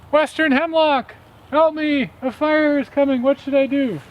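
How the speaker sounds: high, loud frantic mouse cry; a tiny mouse shouting up a tree for help; panicked little mouse calling up high; little mouse yelling. A narrator's reading. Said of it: "And he yelled up to the tree".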